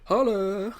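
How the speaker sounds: high, loud cheer; excited holla. Real, not acted. Fan cheering at the TV scene. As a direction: whoop it out in delight. Reacting to probe joke.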